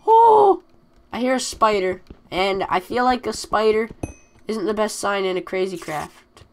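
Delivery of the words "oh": high, loud "oh!" of discovery; delighted surprise. Real, not acted. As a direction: burst out high at a lucky find. Spots multiple amethyst.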